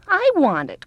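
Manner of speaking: high, loud grabbing cry; greedy cry. A narrator's reading. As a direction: shriek it like a spoilt child. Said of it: "cried the other".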